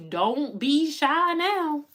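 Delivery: high, slow, drawn-out coaxing; playful sing-song nudge; teasing coax. Acted. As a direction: tease them out of hiding, stretched long. Coaxing the shy audience.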